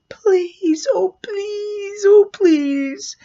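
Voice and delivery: put-on high, begging little boy voice; a young boy begging the clown to show him the trick; pleading child. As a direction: plead up high like a small kid.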